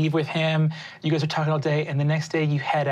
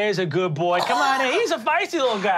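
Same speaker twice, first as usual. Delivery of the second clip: higher, cooing over a dog; baby-talk the pup; higher doting voice for a feisty puppy; doting [name]; 7 semitones higher, faster than usual. Acted. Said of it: "scratches behind your ear".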